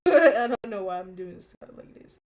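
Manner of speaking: loud laughing repeat. Shown as a demonstration.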